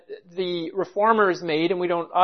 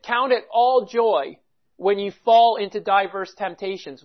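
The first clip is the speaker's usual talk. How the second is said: ordinary delivery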